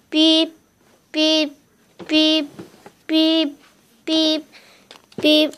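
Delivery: imitated alarm beeping. Child plays her alarm going off, mock electronic beeping. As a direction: beep like an alarm clock.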